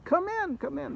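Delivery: welcoming call. Acted. Host calls to rain-soaked travelers at the door, welcoming. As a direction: call them in on a high, lilting note.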